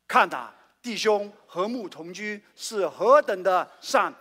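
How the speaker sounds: loud and slow recitation; group scripture reading. Shown as a demonstration. Preacher leads the church reading Psalm 133 aloud, loud and slow. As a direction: proclaim the psalm together, slowly. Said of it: "有一段话一起来念".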